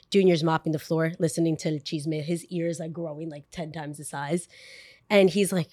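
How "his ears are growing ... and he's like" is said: usual speaking voice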